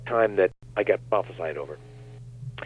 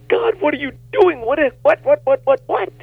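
much higher, rising panic, repeated what what; pleading in a panic, pitched way up; panicky inner plea; 6 dB louder than usual. Retold or imitated. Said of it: "I was on the verge of panic".

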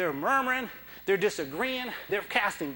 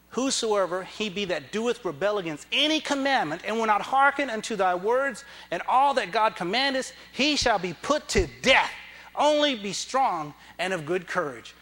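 proclaiming scripture; 2 semitones higher, 4 dB louder than usual. Acted. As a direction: thunder the verse at the congregation. Scripture proclaimed at full force.

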